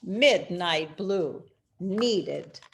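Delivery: ordinary delivery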